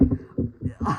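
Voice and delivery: short breathy laugh; amused laugh. Real, not acted. Reviewer cracking up over the awful film, amused laugh. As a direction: break off into a quick laugh.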